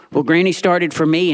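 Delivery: ordinary talk, nothing marked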